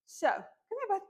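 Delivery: high, coaxing call to a puppy; talking to a dog. Real, not acted. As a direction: coax the puppy to come. Calling the puppy over.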